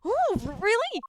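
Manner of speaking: high, delighted character voice; delighted surprise. Acted. Offered a visit to the crime scene, high and delighted. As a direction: swoop up high on the "Ooh".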